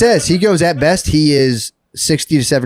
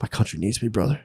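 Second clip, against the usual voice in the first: softer, hushed mock-noble line; mock solemn; 3 semitones lower, 11 dB quieter than usual. Psychic quietly telling his officers he must stay. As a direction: hush it like a mock patriot.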